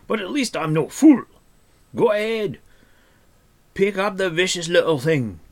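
loud, high, sarcastic tirade; scornful dwarf rant. A narrator's reading. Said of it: "he snorted".

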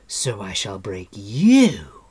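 slow, loud, building to a shriek. A narrator's reading. Queen threatening her captive over the broken sword, shrieking. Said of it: "Artron shrieked".

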